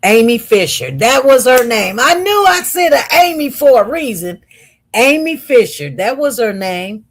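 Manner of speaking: excited recall. Real, not acted. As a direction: burst out as the name finally comes back. Suddenly remembers the name.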